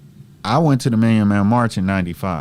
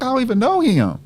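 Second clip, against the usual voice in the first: defensive denial; 3 dB louder than usual. Retold or imitated. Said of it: "I'm like".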